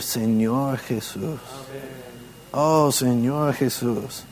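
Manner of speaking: soft, slow, heartfelt call; from deep within. Shown as a demonstration. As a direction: breathe it out slowly and softly. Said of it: "de lo profundo de nuestro ser, decir". (invocación suave y lenta desde lo profundo)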